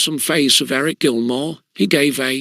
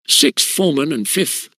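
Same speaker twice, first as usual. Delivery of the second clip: usual speaking voice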